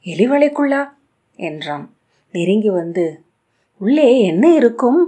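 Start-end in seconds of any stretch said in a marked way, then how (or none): 0.1-0.8 much higher, curious eager question. A narrator's reading. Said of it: "ஆர்வம் கொண்டு". 3.8-5.0 high, loud eager question. A narrator's reading. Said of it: "ஆர்வம் கொண்டு".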